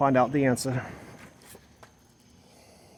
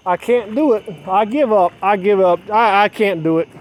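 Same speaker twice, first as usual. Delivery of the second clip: rising, louder, pleading despair; mother's despair; 5 semitones higher, 4 dB louder than usual. Retold or imitated. Imitating the mother as she cries that she gives up. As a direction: wail it out as the mother giving up. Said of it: "she said".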